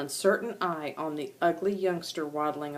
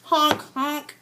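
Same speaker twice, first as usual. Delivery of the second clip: louder, higher honking; goose honk; 8 semitones higher, 5 dB louder than usual. Acted. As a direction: honk like a clumsy little goose.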